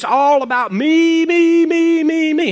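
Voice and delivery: the repeated me climbing high and loud; self-centred mock whine on me. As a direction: whine me, me, me like a spoiled child. Mocking selfish me-me-me attitude.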